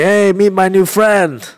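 high, slow mock French accent. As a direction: put on a drawn-out French accent greeting. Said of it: "in this French accent".

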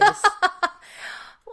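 high, loud cackle; real laugh burst. Real, not acted. Listener laughs at the O J Simpson reference, high and loud.

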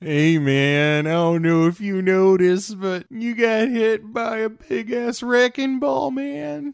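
high, mock-earnest warning voice; mock movie character. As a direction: call out like a worried buddy.

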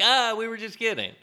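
high-pitched mocking "just kidding" voice; high, hammed-up prankster; mock prankster reveal. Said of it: "And then you're like".

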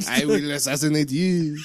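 put-on comical French-accented threat; mock French accent. Joking about French game characters, comical French accent. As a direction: threaten like a cartoon French assassin. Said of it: "glad they don't have French accents".

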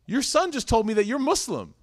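put-on high, loud kid's blurt; blurting little boy. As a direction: blurt it out like an innocent child. Son blurts to the mom.

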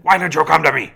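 retold furious yell at his own dog; angry yell. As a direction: yell it out in frustration. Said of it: "yelled at them".

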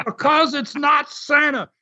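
high-pitched mock outrage; exasperated protest. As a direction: imitate a man at the end of his rope. Retold exasperated wrestler shouting his protest.

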